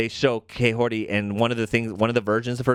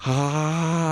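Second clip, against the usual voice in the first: long, higher-pitched imitated roar; mock giant's roar; 5 semitones higher than usual. Host imitates the celestial-size Watcher, drawn-out roar.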